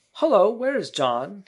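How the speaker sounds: put-on high, loud, slow boyish call; boy's loud question. A narrator's reading. Peter misses the third bed, loud high boy voice. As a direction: ask loudly as a puzzled boy.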